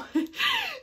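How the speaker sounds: high-pitched little laugh; short laugh. Real, not acted. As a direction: let a quick high giggle slip out. Amused before naming personal favourite.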